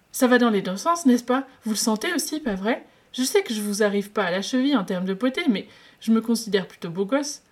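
cheeky lovestruck patient pushing his luck; playful flirt. Acted.

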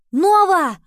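high, very loud urgent call. A narrator's reading. Henry calls Nova after hearing screams, shouting. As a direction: shout the name up the stairwell. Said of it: "позвал Генри".

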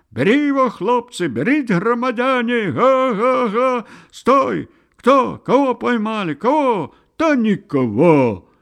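high, loud overlapping-crowd yells; loud, high-pitched clamour; excited crowd shouting. A narrator's reading. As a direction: yell like a mob giving chase. Crowd yelling during a chase.

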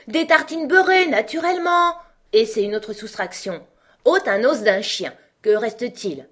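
high, loud queen answering for Alice; brisk quizzing queen. Acted. Red Queen answers for Alice then tests her, brisk and high.

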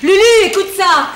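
loud, high summons; calling out. Acted. Wife summons Lulu to hear the call, shouted. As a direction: shout for someone to come and hear.

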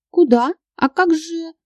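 high-pitched, flustered questions; babbling. A narrator's reading. Marina protests being taken away, flustered and high. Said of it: "залепетала она".

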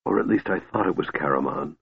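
soft, low-key afterthought; calm. A narrator's reading. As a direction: add it quietly, unmoved. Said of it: "Raistlin's voice was calm".

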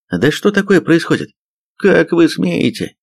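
high-pitched indignant outburst; outraged. A narrator's reading. Major jumps up at the gunpoint standoff, outraged. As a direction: demand an answer, dragging out the affront. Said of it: "подпрыгнул на месте от возмущения".

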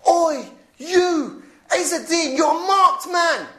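high, loud menacing call, slowing at the end; threatening stranger. Retold or imitated. Stranger outside Asda threatening Izzuddin, loud and slow. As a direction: bark a slow taunt across the car park. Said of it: "one guy turned around and said".

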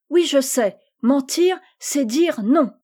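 put-on a small child sure she knows; high eager little-girl voice; proud little girl. Little Isabelle answers proudly.

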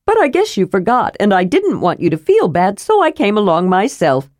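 an innocent kender who tagged along uninvited; cheerful kender. Acted. Tasselhoff speaks.

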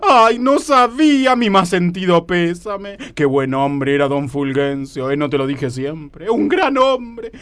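slow, drawn-out funeral sympathy; hammed-up slow mourning; mournful condolence. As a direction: offer condolences with heavy solemnity. Offers condolences for supposed death.

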